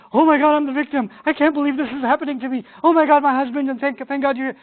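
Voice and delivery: high, loud, mock-distressed wail; playing the victim. Wife turning victim to her husband, high mock distress. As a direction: whimper like a wronged wife, hammed up. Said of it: "to, the switch to the victim".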